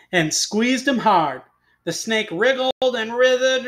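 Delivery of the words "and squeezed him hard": usual speaking voice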